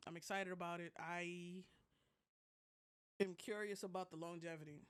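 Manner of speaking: ordinary delivery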